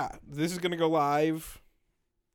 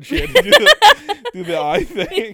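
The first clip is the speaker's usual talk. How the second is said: laughing; 11 dB louder than usual. Real, not acted. Laughing while describing [name].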